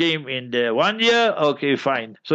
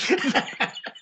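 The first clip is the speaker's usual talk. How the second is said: amused chuckle; 8 semitones higher, 6 dB quieter than usual. Real, not acted. Laughs at his own confession.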